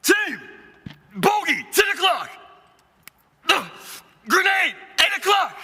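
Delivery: shouting. Acted. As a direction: yell warnings to the squad. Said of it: "And then shout it again".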